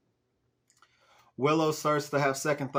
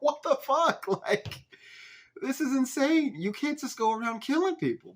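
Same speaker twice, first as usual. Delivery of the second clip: high-pitched, incredulous disbelief; incredulous outburst; 11 semitones higher than usual. Real, not acted. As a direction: react in high-voiced disbelief. Incredulous reaction to the plot.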